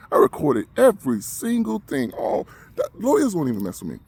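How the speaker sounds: high, loud insistence; real anger, punching out every word; defiant accusation. Real, not acted. Patient insisting every meeting was recorded, defiant.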